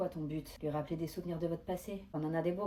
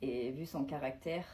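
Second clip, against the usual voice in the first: normal talking voice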